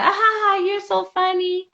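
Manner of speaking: put-on high-pitched parent voice; laughing parent. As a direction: imitate a parent tickled by a child's back-talk. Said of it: "they're just laughing like".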